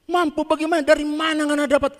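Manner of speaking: high, loud, astonished challenge; shocked pastor. Retold or imitated. Pastor, astonished by Ungke, demands an answer, high and loud.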